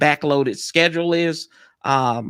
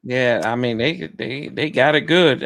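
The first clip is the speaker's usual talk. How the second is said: ordinary talk, nothing marked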